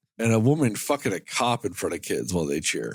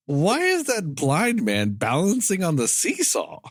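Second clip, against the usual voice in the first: higher, slow incredulous question; baffled; 6 semitones higher than usual. Acted. Podcaster voicing a baffled movie viewer, incredulous. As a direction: puzzle over every word, pitched up.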